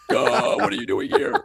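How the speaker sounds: high breathy gasp of mock surprise. Imagining a star walking in, mock surprised. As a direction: squeal it like you're starstruck. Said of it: "you'd be like".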